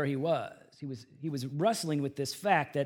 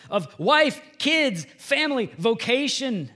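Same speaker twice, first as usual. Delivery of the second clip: raised, loud, building sermon delivery; impassioned preaching; 6 semitones higher, 6 dB louder than usual. Real, not acted. Preacher telling congregation how God rescued him, impassioned preaching. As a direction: pile up each blessing with rising fervour.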